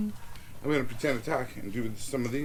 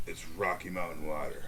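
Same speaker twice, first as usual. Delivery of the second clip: mock ad voice; 9 semitones lower, 10 dB quieter than usual. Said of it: "the Coors being like".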